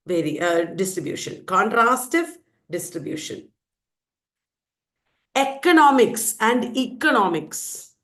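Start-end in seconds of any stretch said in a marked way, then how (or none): 5.3-7.9 first Economics with short "eh", second economics with long "ee"; two vowel variants. Shown as a demonstration. Teacher showing free variation, two opening vowels. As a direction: say "Economics and economics" as EH-konomics and EE-konomics. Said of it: "A and E".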